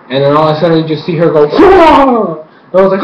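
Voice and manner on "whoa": high, drawn-out "whoa"; imitated startled cry. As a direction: yelp as if suddenly yanked away. Imitates Korra yanked into air.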